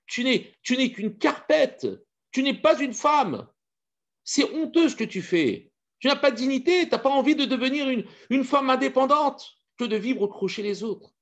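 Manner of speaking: high, loud, slowed scolding; outraged scolding. Acted. Master scolding his servant for having no dignity, high and loud. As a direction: tear into her, indignant and high.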